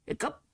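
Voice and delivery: high squeaky hiccup sound; drunken hiccup. Acted.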